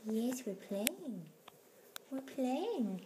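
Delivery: high, slow cooing to kittens; soft, high pet talk. Real, not acted. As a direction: coo softly while they play.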